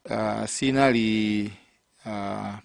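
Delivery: normal talking voice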